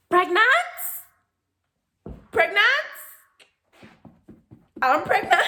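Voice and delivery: high, loud incredulous cries; shocked disbelief. Retold or imitated. Shocked self-quote at positive test.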